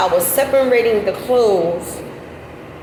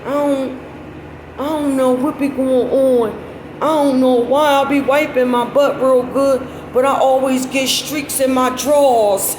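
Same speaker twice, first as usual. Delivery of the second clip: mimicking the boyfriend; 6 semitones higher than usual. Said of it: "He was like".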